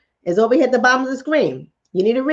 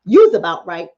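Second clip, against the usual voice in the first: usual speaking voice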